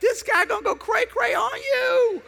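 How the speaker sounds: high falsetto mock warning; squeaky voice warning of a crazy guy. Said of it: "You're like".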